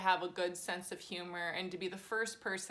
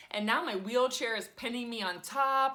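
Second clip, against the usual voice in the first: ordinary talk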